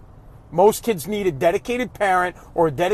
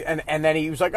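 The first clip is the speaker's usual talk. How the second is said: ordinary delivery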